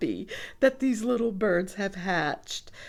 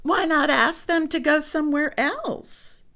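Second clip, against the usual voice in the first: lightly voiced Piggy; 6 semitones higher than usual. Piggy suggests.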